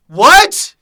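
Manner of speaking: high, loud yelped question; mock shock. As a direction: yelp it in exaggerated disbelief. Mock outburst at own explanation.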